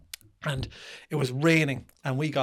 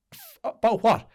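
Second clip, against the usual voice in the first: higher, louder comeback; acted-out baffled retort; retold incredulous question; 8 semitones higher, 5 dB louder than usual. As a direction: throw the complaint back at him. Retold driver feigns puzzlement at complaint.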